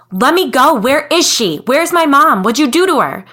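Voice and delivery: high, loud furious yells; furious screaming. Acted. Kid thrashing against strangers, screaming in fury. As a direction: scream in rage at the man holding you. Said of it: "but I was furious".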